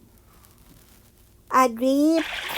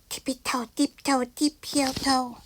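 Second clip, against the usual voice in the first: soft, almost whispered; quiet whispered tiptoe; hushed tiptoe; 8 dB quieter than usual. A narrator's reading. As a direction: sneak it out very quietly. Sneaking back through cave.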